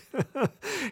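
short breathy chuckle; soft laugh. Real, not acted. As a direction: chuckle at the joke. Laughs at the joke.

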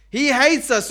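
mock outraged grumbler; bitter complaint. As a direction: fling the accusation out. Acting the Israelites' complaint.

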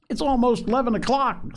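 put-on high-pitched, impatient boy's voice; high, urgent child character; impatient little boy. A narrator's reading. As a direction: read it as a boy pestering his sister.

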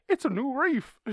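squeaky, gushing mock announcement; mocking high voice. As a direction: play the humans as wide-eyed fools. Mocking humans' claim.

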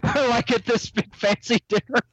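cracking up. Real, not acted. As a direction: struggle to get the story out through laughter. Cracking up telling the Gary story.

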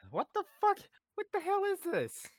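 high, loud startled cry; what-the-hell squeal; shocked outburst. Real, not acted. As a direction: recoil at something gross. Startled by the stress ball.

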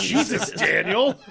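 high, loud, laughing outburst; startled exclamation. Retold or imitated. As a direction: blurt it out in shock. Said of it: "I was like".

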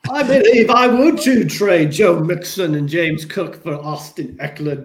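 a horrible put-on Australian accent, laughing into it; bad Australian accent. Co-host greeting an Aussie listener in a deliberately bad Australian accent. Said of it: "I know that was a horrible accent".

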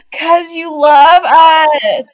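high, loud, drawn-out teasing. Real, not acted. Friend answering why you come here, loud teasing. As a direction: belt it out slowly, playfully pleading.

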